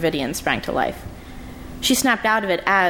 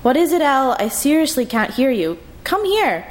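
calling out; 7 semitones higher than usual. Acted. Lee calls down the hall.

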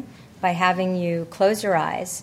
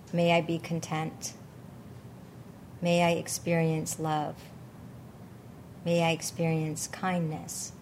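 guided meditation; 6 dB quieter than usual. Acted. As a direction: gently guide them into calm, unhurried. Leads a loving kindness meditation.

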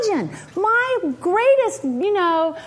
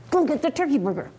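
low, quick, tight command; insistent repeated order; 4 semitones lower, 4 dB quieter, faster than usual. Retold or imitated. Mother repeating an order to her refusing son, low and insistent. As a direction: order it with restrained impatience.

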